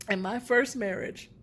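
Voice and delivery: ordinary delivery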